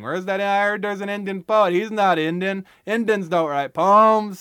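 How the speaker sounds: put-on loud drunk heckler crashing a poetry reading, yelling; drunken Lester yelling. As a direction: stagger in bellowing like a drunk heckler. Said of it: "acting like Lester Falls Apart".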